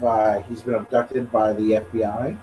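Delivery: ordinary talk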